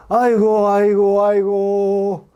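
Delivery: drawn-out mock funeral wail, aigo; hammed-up Korean mourning wail. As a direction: wail like a hired mourner, stretching the last aigo. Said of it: "어떻게 웁니까?".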